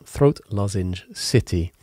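ordinary talk, nothing marked

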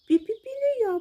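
high piping mouse refusal; squeaky storybook mouse voice; squeaking mouse. A narrator's reading. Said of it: "пищить кут".

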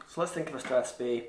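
ordinary delivery

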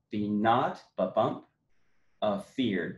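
slow, each stressed beat marked; iambic beat. Shown as a demonstration. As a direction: read it out ba-bump by ba-bump. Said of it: "So that you've got ba-bump".

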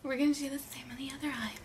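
quick hushed whisper. Real, not acted. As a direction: whisper it fast under your breath. Quiet aside during blindfold makeup.

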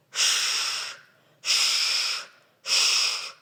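soft voiceless ш, ш, ш like rustling leaves. Shown as a demonstration. Teacher imitating rustling leaves for children, soft ш, ш, ш. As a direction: let ш, ш, ш rustle softly like leaves. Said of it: "А листочки шелестять?".

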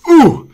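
high, loud thumped grunt; sudden storybook yelp from a punch; gorilla's pained "ooh". Acted. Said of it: "said the gorilla".

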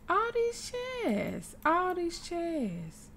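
very high, drawn-out exasperation; annoyed whine. Real, not acted. Player annoyed at sitting in laps despite chairs, high whine. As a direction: wail it slowly, exasperated. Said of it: "I'm annoyed".

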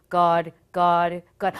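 low, loud one-word plea; imitating a terrified repeated prayer; mock-panicked calling out; scared praying.

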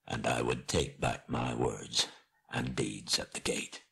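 low, fading farewell; dying dwarf king, solemn. Acted. Dying Thorin parting in friendship from Bilbo, solemn and fading. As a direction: let the voice sink and soften towards the end.

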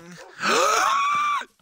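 very high sharp gasp; shocked gasp. Real, not acted. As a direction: gasp in outrage. Finds friend in base.

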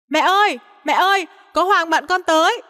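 high-pitched call to mum. A narrator's reading. Son alerts mum that his friend has come, calling out. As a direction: call out a warning, pitched well up. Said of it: "gọi lớn lên để đánh tiếng".

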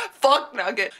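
ordinary talk

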